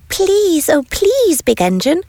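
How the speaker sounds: high, pleading little toy voices. A narrator's reading. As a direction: beg the big engine, high and eager. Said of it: "cried all the dolls and toys".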